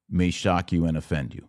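ordinary talk, nothing marked

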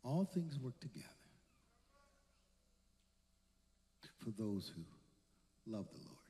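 soft, low scripture in broken phrases; hushed reassurance. Acted. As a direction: let it fall away quietly, with long pauses. Quietly answering the prayer with scripture.

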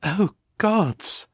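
Ava's startled inner cry; surprised gasp. A narrator's reading. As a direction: caught off guard by the feeling. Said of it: "surprise spilling over the rest".

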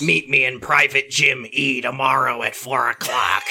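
hammed-up growl for a menacing figure; growly villain voice. A narrator's reading. Chris summons Danny to meet, hammed-up growl. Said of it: "said Chris in an almost growl".

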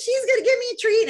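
high, fast, loud dog voice; excited dog. Retold or imitated. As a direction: voice a dog thrilled about a treat. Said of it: "like, all excited".

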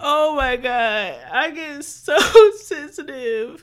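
slow, teary words broken by laughter; teary laughing. Real, not acted. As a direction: laugh through the tears. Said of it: "I'm crying and laughing".

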